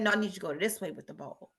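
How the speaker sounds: a calm coach's correction, hushed and fast; low, soft quoted man. Retold or imitated. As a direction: let his correction come low and quiet. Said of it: "He said".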